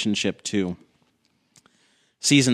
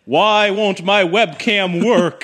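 slow, much higher, louder booming demand; 11 semitones higher, 6 dB louder, much slower than usual. Retold or imitated. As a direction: boom like the great Oz. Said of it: "you sound like you're demanding something".

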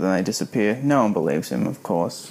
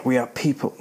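plain reading voice

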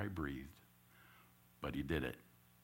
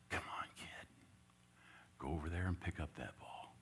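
put-on soft whisper to the kid; whispered coaxing; 10 dB quieter than usual. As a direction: coax him under your breath.

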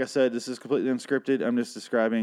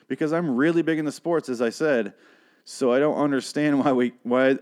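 ordinary talk, nothing marked

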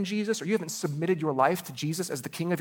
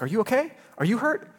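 worried mom; 7 semitones higher, 3 dB louder than usual. Retold or imitated. Mom asking the crying boy.

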